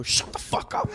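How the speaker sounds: high, whispered mock outburst; exasperated whisper at breaking point; desperate hissed whisper. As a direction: hiss it at the baby under the breath. End of rope, over screaming baby.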